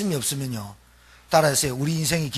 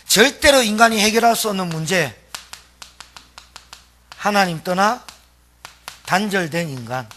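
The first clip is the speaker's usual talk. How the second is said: loud, high, driving sermon voice; preaching at full force; 5 semitones higher, 7 dB louder than usual. Acted. Preacher challenging the congregation on their spiritual poverty, full-force preaching.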